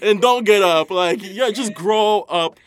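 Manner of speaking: loud, high mocking jeer; scornful outburst. Real, not acted. Mocking a girl who fakes being drunk, loud and scornful. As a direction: jeer at her to fall and stay down.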